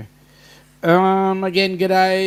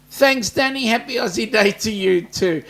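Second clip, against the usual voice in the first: ordinary talk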